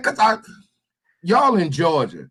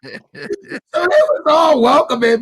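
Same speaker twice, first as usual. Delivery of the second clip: laughing; 6 semitones higher than usual. Real, not acted. Laughing while telling the prank.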